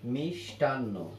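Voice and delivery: মিষ্টান্ন said slowly as mish-tan-no; মিষ্টান্ন slowed down a little to show the sounds; pronunciation demonstration. Shown as a demonstration. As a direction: say মিষ্টান্ন carefully, with a clear sh before the t. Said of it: "কিন্তু উচ্চারণ করি কিভাবে?".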